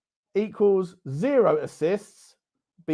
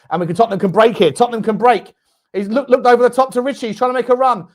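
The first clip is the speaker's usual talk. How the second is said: fast, louder play-by-play on the break; excited commentary; 3 semitones higher, 5 dB louder, faster than usual. Real, not acted. Fan commentating a Tottenham break, fast and excited. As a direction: speed up as the counterattack builds.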